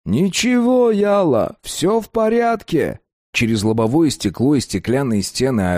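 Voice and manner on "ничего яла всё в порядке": high, slow, unbothered tough guy; calm thug voice. A narrator's reading. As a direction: soothe him lazily like a crook. Mamud voiced again.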